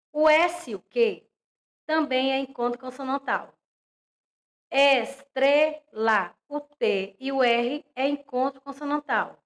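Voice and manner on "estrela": Crocodilo stretched slowly, louder, each syllable clear; careful classroom modelling of Crocodilo; slow syllable demo. Shown as a demonstration. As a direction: spell out Crocodilo for children, syllable by syllable. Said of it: "teacher models example word".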